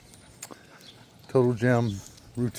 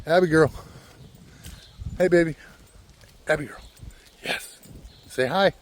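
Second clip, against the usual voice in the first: higher, affectionate call to Abby; pet-talk, pitched up; calling to a companion; 4 semitones higher than usual. Real, not acted. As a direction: coax Abby to say hi. Calls to walking companion Abby.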